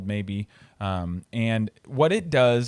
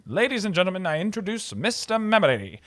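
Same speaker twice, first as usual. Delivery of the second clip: higher, a hammed-up stage announcer; showman's introduction; 8 semitones higher than usual. Introducer presenting Mister Memory to the theater crowd, a mock grand announcement. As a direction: present the act to the crowd with a flourish. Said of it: "the other guy says".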